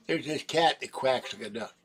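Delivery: normal talking voice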